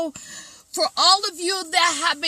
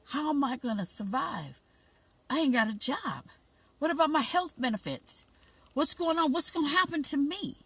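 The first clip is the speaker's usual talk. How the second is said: fearful inner voice; 6 dB quieter, faster than usual. Retold or imitated. Voicing shoppers' inner fears.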